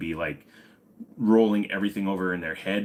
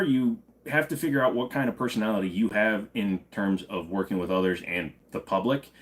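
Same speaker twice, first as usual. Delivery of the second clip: ordinary talk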